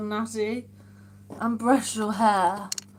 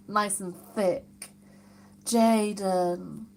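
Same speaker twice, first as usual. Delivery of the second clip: ordinary delivery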